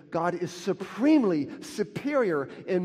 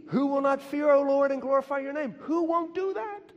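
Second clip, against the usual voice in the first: high, loud rising fervor; fervent preaching at a high pitch; excited exclaim; 9 semitones higher, 5 dB louder than usual. Acted. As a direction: lift the verse up with excitement.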